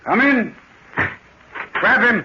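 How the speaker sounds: spring the trap, bark the order; sudden command. Acted.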